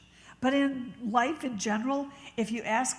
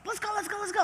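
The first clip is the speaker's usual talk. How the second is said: high, hurried urging; imitated hyper rushing; 11 semitones higher, 3 dB louder, much faster than usual. Sister-in-law rushing her along, imitated hyper urging. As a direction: mimic someone in hyper gear, pitched way up. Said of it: "She's always in hyper gear".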